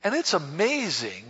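normal talking voice

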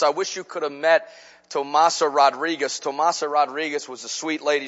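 usual speaking voice

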